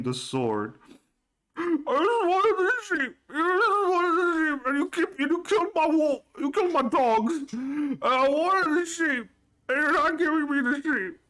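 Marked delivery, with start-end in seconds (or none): 1.6-11.2 tearful whine. Acted. GM as Gus blubbering to the party, much higher tearful whine. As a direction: cry like a big kid. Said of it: "tears forming in his eyes".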